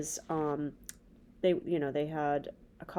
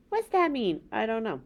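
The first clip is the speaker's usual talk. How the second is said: ordinary delivery, nothing marked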